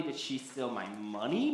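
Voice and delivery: "money" lifted as a rising question; stress on MONEY. Shown as a demonstration. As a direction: save the push for "money". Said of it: "it changes of who's accusing who".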